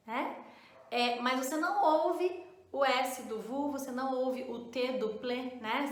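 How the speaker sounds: normal talking voice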